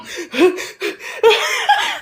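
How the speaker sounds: sudden high burst of laughter. Real, not acted. Telling friends her friend was asleep, bursts into high laughter. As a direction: crack up at the thought.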